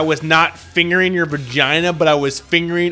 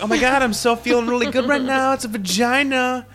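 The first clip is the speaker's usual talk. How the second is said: high-pitched hammed-up glee; mock excited; 8 semitones higher than usual. Boyfriend excited in bed, high mock glee. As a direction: gush like an overeager boyfriend. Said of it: "And like".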